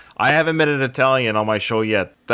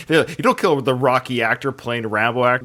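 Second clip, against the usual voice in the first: ordinary talk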